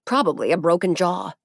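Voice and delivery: ordinary delivery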